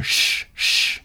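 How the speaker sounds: unvoiced "shh", twice; hushing shh. A narrator's reading. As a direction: hush someone with a long shh. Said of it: "bade her".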